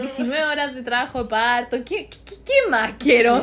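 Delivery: loud, exasperated listing of woes; fed-up rant, played for laughs; retold exasperation. Acts her exasperated rant in labour.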